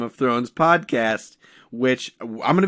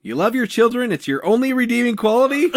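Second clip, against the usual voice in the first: whole line pitched higher, ending on a rising question; questioning Tyrion attempt; 9 semitones higher than usual. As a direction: read it high as if unsure, rising at the end. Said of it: "How many question marks did you write".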